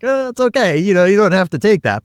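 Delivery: reassuring. Retold or imitated. Reassuring someone put off.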